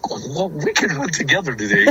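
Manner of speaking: high, hopeful mock-kid suggestion; imitating reluctant brother. Nervous little brother wanting to stay beside him, imitated as reluctant. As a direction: pitch it up like a nervous youngster hedging. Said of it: "He did not want to leave my side".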